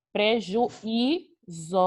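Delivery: "Prejuízo" very slowly, split pre-ju-í-zo; syllable by syllable. Shown as a demonstration. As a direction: separate and stretch each syllable of "Prejuízo". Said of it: "a separação silábica". ("Prejuízo" separado em sílabas, bem devagar)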